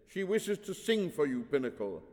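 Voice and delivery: urgent suggestion. A narrator's reading. Father diverting the priest to singing, urgent. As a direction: cut in urgently. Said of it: "Urgently, Anthrax suggested".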